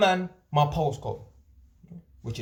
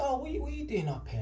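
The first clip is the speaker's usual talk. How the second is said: much higher, incredulous question; baffled cashier; 10 semitones higher, 6 dB quieter than usual. Retold or imitated. Retelling the B and Q cashier asking why he's here, mock baffled. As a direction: mimic the cashier's surprise.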